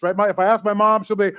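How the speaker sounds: ordinary delivery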